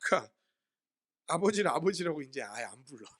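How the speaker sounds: ordinary talk, nothing marked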